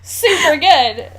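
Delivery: high, slow, mock enthusiastic; fake-delighted sign-off; hammed-up editor approval. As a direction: stretch it out like a smug editor.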